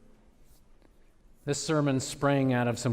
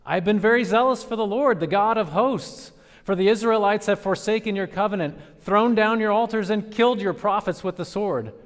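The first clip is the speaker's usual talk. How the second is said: Elijah's complaint; 7 semitones higher, 6 dB louder than usual. Acted. Elijah answering God: loud protest fading away.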